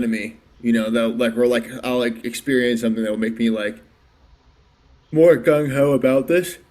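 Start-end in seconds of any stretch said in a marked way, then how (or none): 5.1-6.6 talking through a real yawn, drawn out and higher; words swelling into a yawn. Real, not acted. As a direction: let a yawn stretch the words slowly. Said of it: "I'm yawning".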